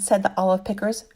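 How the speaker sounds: usual speaking voice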